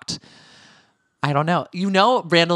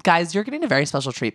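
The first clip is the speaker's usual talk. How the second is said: ordinary delivery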